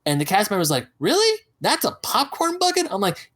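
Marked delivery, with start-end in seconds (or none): 1.0-2.9 high-pitched questioning disbelief; mock incredulous. Cast member questions the odd neck bucket, imitated incredulity. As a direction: ask it high, as if amazed.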